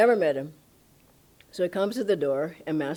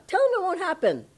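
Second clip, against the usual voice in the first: high, loud imitated guru; emphatic dismissal; 12 semitones higher, 5 dB louder, faster than usual. Guru answers a disciple afraid of dying, emphatic and high. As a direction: brush the prediction off at high pitch. Retold guru defies a prediction.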